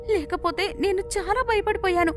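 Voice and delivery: put-on high-pitched voice of a relieved girl. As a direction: speak high, as if finding company after a scare. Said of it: "Manisha హాయిగా గాలి పీల్చుకుంది".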